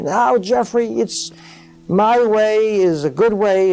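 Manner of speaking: high, slow and insistent; arguing, voice up. Retold or imitated. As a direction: press your point in a mock argument. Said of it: "let's say we were having an argument".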